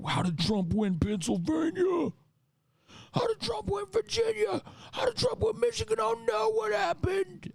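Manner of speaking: squeaky, shrill falsetto panic, slowing down; cartoonish high-pitched dismay; mock panicked voters. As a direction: ham up the baffled freak-out in a very high voice. Panicked voters after election loss.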